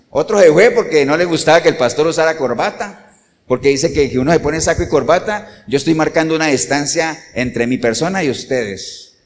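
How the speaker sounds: ordinary delivery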